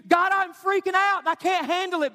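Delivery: high-pitched, mildly frantic plea; panicked prayer. Acted.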